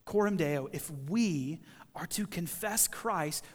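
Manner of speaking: ordinary talk, nothing marked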